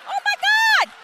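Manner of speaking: high swooning gasp of delight; breathless smitten oh my God. Retold or imitated. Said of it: "And I was like".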